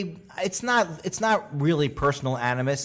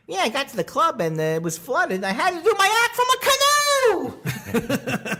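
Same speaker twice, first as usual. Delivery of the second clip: hacky comic; 4 dB louder than usual. Retold or imitated. Mocking a hacky comic telling his woes. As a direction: squeal it like a washed-up comic.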